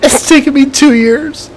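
very high, half laughing, half crying; elated tearful. Real, not acted. Gamer celebrating two-year challenge win, tearful elation. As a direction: let the joy spill over, voice cracking.

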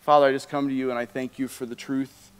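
a soft, earnest, unhurried prayer; heartfelt pastoral prayer. Real, not acted. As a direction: pray quietly over the worship music. Closing prayer over music.

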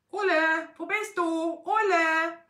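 high, loud drawn call; shouted search call. A narrator's reading. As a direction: holler for someone who is out of sight. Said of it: "die Zwillinge rufen".

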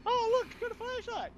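very high mocking squeal of delight; mocking squeaky voice. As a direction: squeal it like a giddy kid. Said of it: "It's like".

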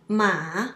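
ma dipping low, then rising; third tone. Shown as a demonstration. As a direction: dip and lift on ma. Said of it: "It's different tones".

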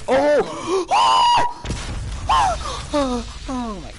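high shout and gasp, drawn-out oh my god; shocked shout. Real, not acted. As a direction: blown away by the play. Sudden big play in game.

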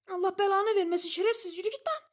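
loud, high furious cursing; angry curse. Real, not acted. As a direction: spit it at the enemy loudly. Cursing opponent.

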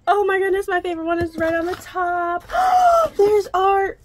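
high, loud squeals of delight; screaming with excitement. Real, not acted. Fan unboxing a dream book box, screaming with joy. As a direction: shriek over the box contents. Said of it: "I cannot be screaming and yelling like this".

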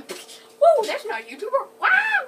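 sudden loud high yelp of surprise; startled shout. Real, not acted. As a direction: cry out in shock, then laugh it off. Startled outburst mid-list.

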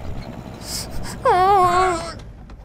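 high laugh breaking into tears; crying laugh. Acted. Bea laughing bitterly at her drunk friend, near tears. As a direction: laugh that turns into sobbing. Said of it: "clearly it was tears".

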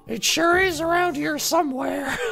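high, croaky cartoon mom voice; sounds like Marge Simpson. Gamer's pirate voice slipping into a high Marge Simpson rasp. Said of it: "I sound like Marge Simpson".

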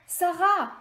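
yelled. A narrator's reading. The group calling Sarah from the ladder, shouting. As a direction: shout her name up the ladder. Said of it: "hurle le reste du groupe".

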